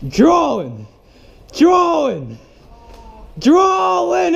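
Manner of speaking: rising, repeated high yells; climbing panicked calls; alarmed shouts. Real, not acted.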